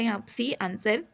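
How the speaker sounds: ordinary delivery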